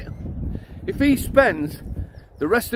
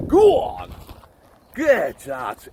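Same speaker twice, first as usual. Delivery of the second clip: urging the dog; 6 semitones higher, much slower than usual. Real, not acted. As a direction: shoo him on firmly.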